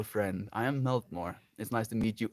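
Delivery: ordinary talk, nothing marked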